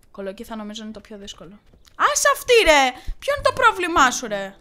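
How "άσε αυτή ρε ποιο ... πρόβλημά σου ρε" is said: much higher, louder angry yell; angry shout. Real, not acted. Player yelling at the gardener, high, loud and angry. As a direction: snap at him in outrage.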